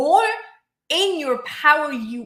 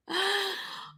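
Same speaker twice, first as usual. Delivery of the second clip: mock gasp; 10 semitones higher, 8 dB quieter than usual. Imagined panic of client results.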